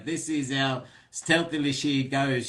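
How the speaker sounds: ordinary talk, nothing marked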